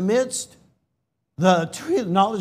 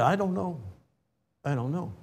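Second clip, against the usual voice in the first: quiet admission; 2 semitones lower, 10 dB quieter, faster than usual. Real, not acted. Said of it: "admitting mystery to congregation".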